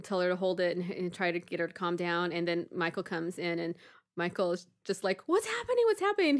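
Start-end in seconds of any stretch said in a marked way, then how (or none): 5.3-6.4 put-on high, alarmed kid-brother questioning; imitated upset brother. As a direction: imitate a worried younger brother. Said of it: "he starts getting really upset".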